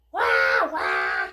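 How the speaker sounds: loud cartoon bawl; big imitation cry; mock wail. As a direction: wail loudly like a crying little brother. Imitated crying in story refrain.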